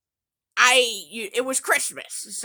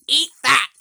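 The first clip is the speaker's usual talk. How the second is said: gloating taunt; 2 semitones higher, 6 dB louder than usual. Real, not acted. Triumphant taunt at brother.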